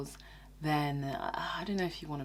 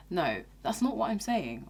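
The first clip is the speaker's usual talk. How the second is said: ordinary talk, nothing marked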